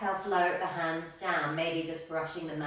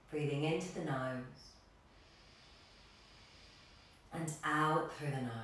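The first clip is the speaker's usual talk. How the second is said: guided meditation; 3 semitones lower, 4 dB quieter than usual. Real, not acted. Yoga teacher settling a class into stillness, guided meditation. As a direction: settle them gently into stillness, voice easing down.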